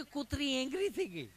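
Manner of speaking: mock an English-educated visitor in a mild fluster. Visitor reports to Hiteshbhai that the dog got angry. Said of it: "English ભણેલું".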